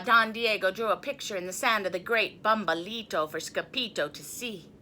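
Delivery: ordinary delivery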